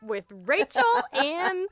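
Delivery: high, slow, hammed-up show intro; mock podcast host. Host introducing a joke podcast, mock announcer voice. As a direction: announce it like a silly show opener.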